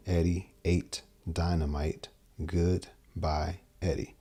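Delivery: slow, spaced-out mnemonic, each word separate. Shown as a demonstration. Guitar teacher mapping a memory phrase onto strings, slowly word by word. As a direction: recite it slowly, pausing between the words. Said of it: "from here to here".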